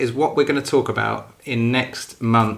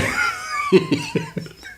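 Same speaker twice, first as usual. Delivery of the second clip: very high, squealing laugh; laughter. Real, not acted. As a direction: burst into high-pitched laughter. Laughing at the real bae remark.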